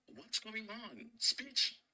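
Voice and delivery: high, drawn-out bewildered question; baffled friend. Retold or imitated. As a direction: sound totally lost at what your friend is doing. Friend baffled by the narrator's panic.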